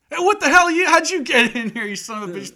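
very high, loud outraged shriek; landlady shrieking. Retold or imitated. The landlady finding the robber, in a shrieking yell. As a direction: screech at the intruder. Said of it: "He's like".

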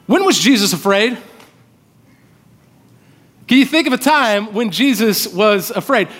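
ordinary talk, nothing marked

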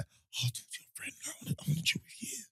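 very soft whispered imitation of a girl; barely voiced, hushed girl impression; girl's coy whisper. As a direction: whisper it like a girl angling for your friend. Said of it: "the girl comes to you and says like".

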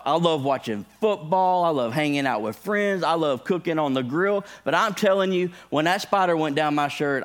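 normal talking voice

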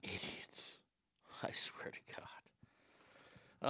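whispered, barely audible aside; exasperated whisper. Real, not acted. Host fed up with the easily offended, exasperated whisper. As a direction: mutter it under your breath, drawn out. Disgusted aside after the mocking.

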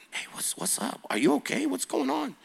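soft concerned whisper; hushed whisper. Retold or imitated. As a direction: lean in and whisper gently to her. Whispering to scared girl in prayer.

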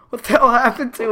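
high, loud outraged disbelief; imitating her own shocked outburst. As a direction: shout at the crushed basket. Finds her basket crushed.

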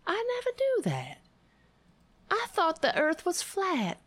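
high, fast faux-naive gasp; sarcastic mock surprise. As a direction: play dumb, high and quick.

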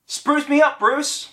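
"Spruce me up, Bruce" said high and quick, a casual first go; activation phrase, first try. Shown as a demonstration. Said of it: "Please say my activation phrase".

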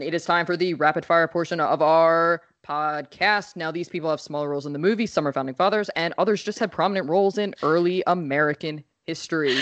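fast auctioneer patter; rapid fire voice. Acted. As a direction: rattle it off like an auctioneer. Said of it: "I love your rapid fire voice".

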